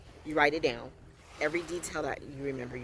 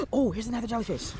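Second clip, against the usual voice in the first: gasping, quick and excited; surprised gasp; 5 semitones higher, 3 dB louder, faster than usual. Real, not acted. Spotting a jellyfish while filming, gasping. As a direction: gasp as you spot it. Spots jellyfish mid-talk.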